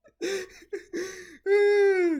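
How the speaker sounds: shrill wild cackle; loud cackling laugh; loud high laugh. Real, not acted. Laughs at own joke.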